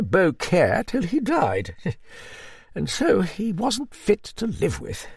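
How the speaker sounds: put-on high-pitched hearty old dame; old Duchess. Narrator voices the old Duchess.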